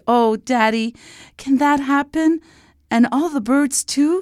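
put-on high, delighted child's voice; high-pitched hopeful child; beaming little princess. A narrator's reading. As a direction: ask hopefully like a thrilled little girl. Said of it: "Liana beamed".

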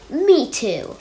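squeaky-small echo of mother; young kangaroo. A narrator's reading. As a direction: pipe up like a small child. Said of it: "That young kangaroo in her".

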